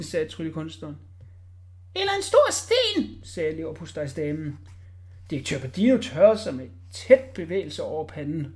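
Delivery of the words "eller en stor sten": much higher, slow lady; a fussy lady's guess. A narrator's reading. Liver-pâté lady guessing at the box, high.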